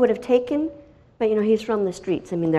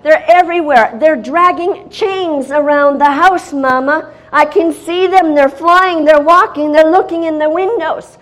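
higher and louder, panicked calls for mom; frantic son; 9 semitones higher, 11 dB louder than usual. Retold or imitated. Son running in to warn his mother, frantic loud alarm. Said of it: "He comes running in the room".

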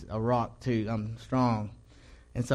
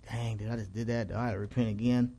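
softer, understated self-reproach; retold guilty thought; 7 dB quieter than usual. Churchgoer feeling guilty after the party, quietly to himself. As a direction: say it under your breath, as if to yourself.

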